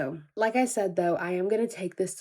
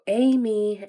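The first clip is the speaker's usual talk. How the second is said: drawn-out barista call, louder; 3 semitones higher, 7 dB louder than usual. Retold or imitated. Barista calling for Amy again, louder mock call. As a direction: imitate calling out a coffee order. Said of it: "the barista is there calling for Amy".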